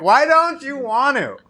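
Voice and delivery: high, slow, hammed-up pleading; drawn-out mock whine at the friend; mock whiny plea. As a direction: stretch it out like a sulky drunk.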